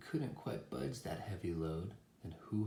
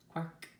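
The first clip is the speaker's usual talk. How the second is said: short, high quack; duck quack; 9 semitones higher than usual. A narrator's reading. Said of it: "said a duck".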